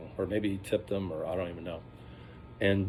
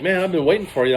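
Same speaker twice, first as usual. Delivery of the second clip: eager welcome; 9 semitones higher, 8 dB louder, faster than usual. Retold or imitated. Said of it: "So I'm like".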